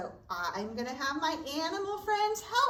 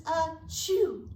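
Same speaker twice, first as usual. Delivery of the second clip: mock sneeze. Bear sneezes in story.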